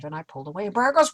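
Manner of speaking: usual speaking voice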